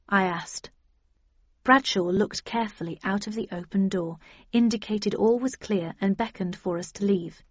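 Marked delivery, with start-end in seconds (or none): none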